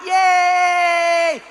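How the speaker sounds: high, drawn-out gleeful "yay"; fun cheer. Acted. Happy cyclist having a fun time, long high cheer. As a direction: cheer like someone on a joyride. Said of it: "That's like a fun time".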